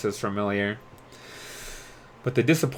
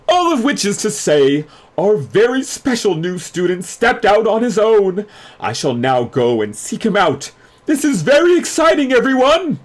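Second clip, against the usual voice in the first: high, loud and theatrical, announcing grandly; a pompous headmaster hyping the crowd; head mage hyping his special new student, high and loud; showy head mage; 12 semitones higher, 8 dB louder than usual. Acted. Crowley announcing in the recording.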